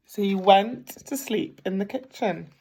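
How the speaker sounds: plain reading voice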